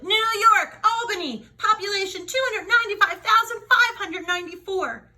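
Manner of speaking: high, loud know-it-all blurt; show-off interruption. A narrator's reading. Camilla interrupting the teacher, high and loud. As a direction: cut in and show off. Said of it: "Camilla interrupted".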